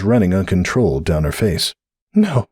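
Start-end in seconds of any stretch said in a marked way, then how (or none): 2.1-2.5 high tearful no; tearful denial. A narrator's reading. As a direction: refuse to believe it. Cupcake in denial.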